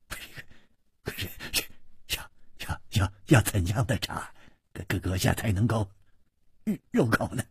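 soft, halting stammer; smiling through strain. A narrator's reading. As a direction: stutter politely, struggling to stay obliging. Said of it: "脸上还是带着笑".

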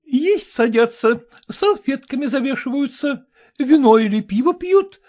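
high, brisk society matron; brassy lady voice. A narrator's reading. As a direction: play the bossy choir mistress. Kolkina voiced by reader.

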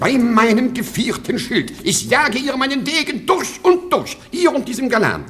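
high, loud, raging threat; furious outburst. Acted. Baron raging at his son-in-law over his daughter's honour, furious shout. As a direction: bellow it like an outraged nobleman.

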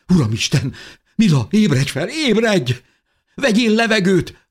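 high, loud cries, rising in pitch; frantic pleading. A narrator's reading. Mother shakes her unconscious child awake, frantic. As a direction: build from alarm to a desperate shout.

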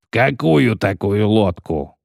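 slow, unruffled question; calm question. A narrator's reading. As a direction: ask it calmly, taking time. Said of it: "спокойно спросил Адарио Бах". (спокойный неторопливый вопрос)

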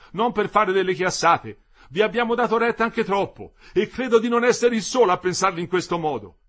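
high, loud rebuttal; heated retort. A narrator's reading. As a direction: argue back insistently. Worker retorts in heated dispute.

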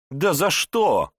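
high, loud, slow outraged cry; indignant cry. Acted. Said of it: "снова возмутилась Аманда".

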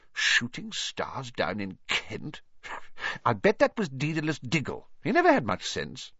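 high, fussy professor's voice; disapproving professor. Acted.

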